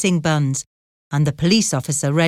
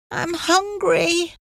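put-on small boy voice; 12 semitones higher, slower than usual. A narrator's reading. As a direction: whimper it like a hungry child. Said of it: "said William".

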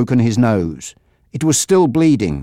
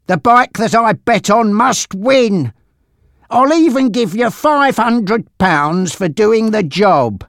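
high-pitched, emphatic menace; bully him into taking the deal; persuading gangster Frankie; 8 semitones higher, 3 dB louder than usual. Acted.

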